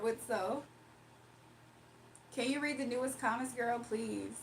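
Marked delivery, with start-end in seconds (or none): none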